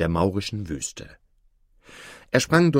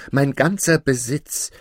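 higher, louder lament; calling out; 5 semitones higher, 5 dB louder than usual. A narrator's reading. Aladin lamenting his lost property, calling out. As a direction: call out in dismay. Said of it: "rief Aladin".

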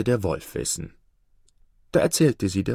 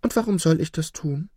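higher-pitched, inquiring fox; curious fox; 7 semitones higher than usual. A narrator's reading. Fox asking the trapped wolf for a reason, curious. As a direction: ask it high, with curiosity. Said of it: "fragte der Fuchs neugierig".

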